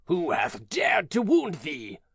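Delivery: a touch of restrained anger, slowly; understated anger. Acted. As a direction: giant asking who hurt the child, held back. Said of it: "he said".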